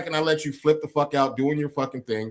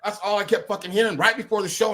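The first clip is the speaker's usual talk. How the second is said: ordinary talk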